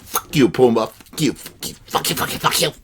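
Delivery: starts plain and fades to a whisper; cartoon meerkat, fading. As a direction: let the repeats die away to a breath. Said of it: "He's like".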